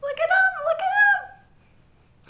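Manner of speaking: very high, drawn-out fangirl squeal; piercing high excitement, stretched out; thrilled squeal. Real, not acted. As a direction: squeal at the screen in delight. Thrilled fan watching the couple.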